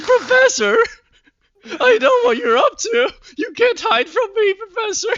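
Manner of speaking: high, hammed-up melodramatic scream; shouting. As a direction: confront him at full volume, voice straining high. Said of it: "he's literally shouting in his speech bubbles".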